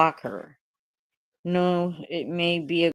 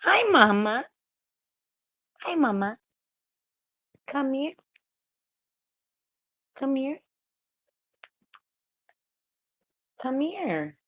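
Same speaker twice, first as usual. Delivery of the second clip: higher, softer pet-talk voice calling the dog over; sweet little coaxing call to a pet; 5 semitones higher, 6 dB quieter than usual. Real, not acted. Coaxing her pet to come.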